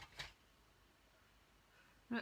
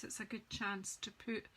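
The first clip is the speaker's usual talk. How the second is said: normal talking voice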